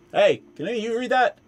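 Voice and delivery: high, quick halfling voice; small nosy halfling. Acted.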